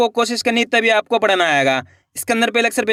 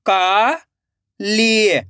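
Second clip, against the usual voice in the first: काली split into का, long pause, ली; rising का, then falling ली; syllable by syllable; much slower than usual. Shown as a demonstration. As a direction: sound out Kali slowly in two parts. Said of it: "एक एक अक्षर का उच्चारण करना है".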